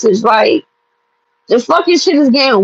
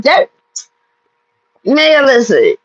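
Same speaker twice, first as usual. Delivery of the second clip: normal talking voice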